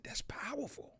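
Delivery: breathy whispered awe. Real, not acted. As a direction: whisper it like a quiet revelation. Reacting to the quote.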